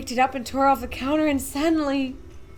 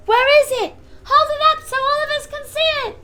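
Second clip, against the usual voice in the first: much higher, much louder crowd voice; eager shout; 9 dB louder than usual. A narrator's reading.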